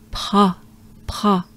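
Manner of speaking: "pa" with a breathed French R fused after the P; P-R linked. Shown as a demonstration. Teacher emphasizes the hard cluster, P-R linked. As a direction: fit the French R straight after P in "pa". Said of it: "trying to emphasize on this".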